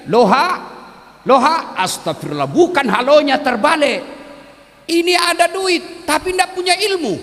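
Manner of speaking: lightly voiced old man mixing up hello; confused old man. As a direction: bluster it out, high and confused. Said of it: "Dia bilang lagi".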